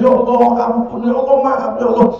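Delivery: high, wailing mock sobbing prayer; imitated tearful pleading. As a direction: beg forgiveness as if crying. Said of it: "nangis, nangis".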